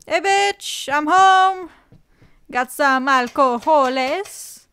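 loud, hammed-up call through the house; mock homecoming holler. Pretend spouse coming home with alcohol, hammed-up holler. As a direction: announce yourself loudly, dragging out the last words.

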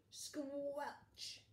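ordinary delivery

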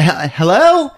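put-on high, chirpy phone voice of a startled young woman; mock ingenue, high and bright; high girly voice. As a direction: answer the phone like a ditzy heroine. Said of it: "She said".

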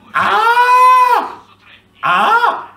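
loud, high open-throated yell; real shout. Real, not acted. Player yelling inside the VR cockpit, full-throated joyful yell. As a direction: let out a full-body yell of joy. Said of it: "quando urlo vibro tutto".

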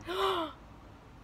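a high surprised gasp; sharp gasp. Retold or imitated. Stranger spotting the cow, sharp gasp. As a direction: suck in air in astonishment. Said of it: "he's like".

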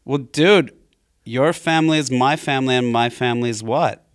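loud bro impression, slowing at the end; Pauly Shore. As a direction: protest loudly like a wounded bro. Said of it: "you guys should have a Pauly Shore off".

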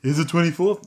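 put-on high, cooing baby talk. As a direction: ask it like a little baby. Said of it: "I'm using my baby voice now".

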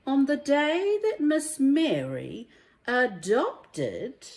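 lively, sing-song rhyming storybook read; reading to children. A narrator's reading. Teacher reading a rhyming picture book to children, lively and sing-song. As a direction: bring each rhyme to life for little listeners.

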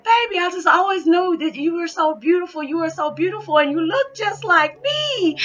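high, loud, tearful gushing; tearful dad. Retold or imitated. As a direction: gush through tears like a father overwhelmed. Crying dad praises his daughter.

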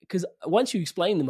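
ordinary delivery

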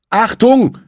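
a sharp, high, loud warning yell; shout. A narrator's reading. Emilio warning his leader, shouted loud and high. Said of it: "schrie Emilio seinem Anführer zu".

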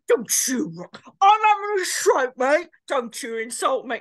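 mock indignation, mouth pulled to one side; twisted-mouth anger. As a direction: protest through a contorted, lipless mouth. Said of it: "Looks like he's having a stroke".

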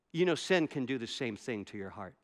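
low, hushed, sobering word; quiet inner voice. Retold or imitated. The Spirit stopping a man in his tracks, low and quiet. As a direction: drop low and soft, like a prompting within.

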